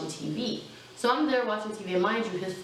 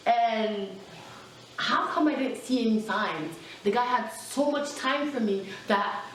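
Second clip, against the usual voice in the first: usual speaking voice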